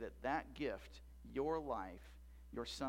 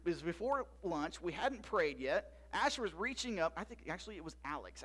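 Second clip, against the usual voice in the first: ordinary talk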